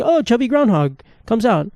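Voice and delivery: put-on a light take on a thrilled little kid; excited five-year-old. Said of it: "when you're a five-year-old 'cause you're like".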